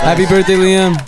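high, loud birthday call-out. Real, not acted. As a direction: call it across the room as he leaves. Calling out farewell greeting.

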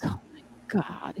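hushed, slow near-whisper; awed aside. Real, not acted. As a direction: drop to a stunned, drawn-out whisper. Awed aside recalling the audition.

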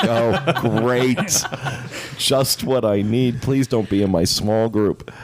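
sarcastic "just my luck" voice; sarcastic dismay. Retold or imitated. Retold dismayed inner thought.

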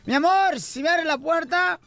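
put-on slow, drawn-out drunken coaxing; wheedling drunk. Drunk husband bargaining to get inside, slow wheedling. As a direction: coax slowly like a drunk husband. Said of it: "el borracho dice".

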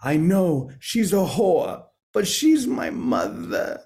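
slow, high Boston accent impression of an old friend; thick Boston accent. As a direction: drag it out in a heavy Boston accent. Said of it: "his very thick accent".

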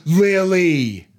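high, loud name, drawn out in disbelief; shocked scold. Shown as a demonstration. Owner showing her dog-correcting tone, shocked and loud. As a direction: say the name as if shocked she'd bark. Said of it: "There it is".

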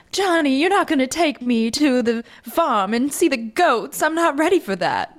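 playful mid-Atlantic accent impression of an old movie heroine; mid-Atlantic breathless woman. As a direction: put on a mid-Atlantic film-star accent as a dame. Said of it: "a breathless woman like".

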